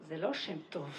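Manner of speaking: low, soft, dismissive clerk; deadpan the official's verdict; clerk's stern voice. Retold or imitated. Immigration clerk tells her: stern, low official voice. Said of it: "said to me".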